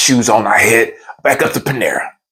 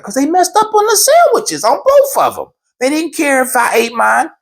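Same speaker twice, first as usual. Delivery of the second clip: high-pitched, indignant excuse; retold squealed self-justification; defensive protest; 14 semitones higher than usual. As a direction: argue your case, pitched way up. Retold defensive argument with wife.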